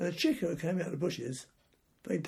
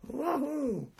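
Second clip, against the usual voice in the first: mock joyful whoop; 9 semitones higher than usual. Soldier waking with no hangover, mock high whoop.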